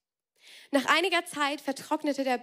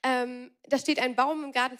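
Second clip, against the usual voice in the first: ordinary delivery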